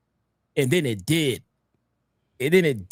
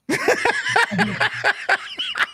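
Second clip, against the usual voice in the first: long, high-pitched real laugh; high laughing fit. Real, not acted. Host cracks up at a terrible show, high laughing fit.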